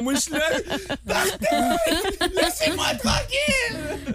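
high, hammed-up wailing plea; mock distress. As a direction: wail high and pleading, half laughing. Wailing at the robot at night.

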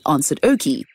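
plain reading voice

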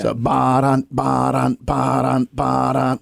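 ba-dun repeated like a driving beat; vocal drum rhythm. Shown as a demonstration. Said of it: "dash dot".

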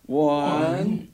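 counting reps through laughter; laughing while counting push-ups; counting workout reps. Real, not acted. Counting Tom's push-ups aloud.

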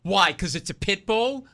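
loud, accusing mock question; indignant challenge. Dog owner to the couple in the park, indignant challenge. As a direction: throw it back as an affronted challenge. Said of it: "he goes like this".

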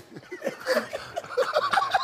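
a high burst of real laughter. Real, not acted. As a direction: crack up at the joke.

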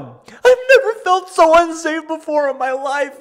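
very high, loud, wailing parody of her sobbing; mock crying woman. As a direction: ham up the tearful panic. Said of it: "And she's, like, crying".

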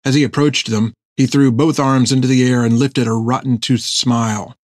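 plain reading voice

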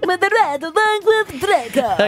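radio announcer. Acted. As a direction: hype it like a late-night radio host. Mock radio host promo.

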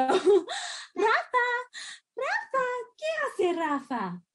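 parentese. Real, not acted. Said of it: "my voice just went up".

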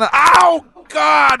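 loud high "ow"; pain yell. Real, not acted. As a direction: cry out in pain. Strip ripped near the arm.

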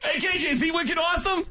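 high, excited teasing. Real, not acted. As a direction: egg him on playfully. Host teasing guest with Boston slang.

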